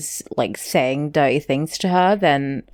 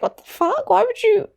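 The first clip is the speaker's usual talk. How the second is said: baffled. Retold or imitated. Host retelling Charlotte's reaction in bed, mock baffled. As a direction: imitate someone stunned and confused. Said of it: "she's too confused to say anything".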